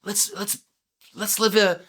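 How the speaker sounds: high-pitched, eager invitation to adventure; excited, playful urging; eager enthusiasm. Acted. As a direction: pitch it high, like proposing fun together. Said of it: "filled with joy and enthusiasm".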